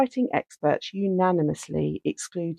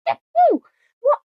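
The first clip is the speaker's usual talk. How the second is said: incredulous shriek; 8 dB louder than usual. Real, not acted. Disbelief at the note.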